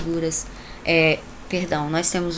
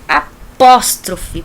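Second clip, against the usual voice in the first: slow, heavy, loud "Apóstrofe"; emphatic apóstrofe; 10 dB louder, much slower than usual. Shown as a demonstration. Teacher stressing the term for students, loud and slow. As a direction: say "Apóstrofe" loud and drawn out, like a strong name. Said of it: "parece até apóstolo".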